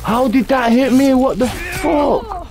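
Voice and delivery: high furious yell at the game; raging gamer. Real, not acted. Boss hit, gamer raging.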